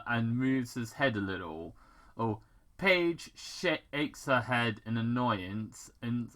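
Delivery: ordinary delivery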